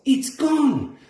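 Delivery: high dismayed cry. A narrator's reading. Peso finding the seaweed gone, high dismayed cry. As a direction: wail the loss, pitched high.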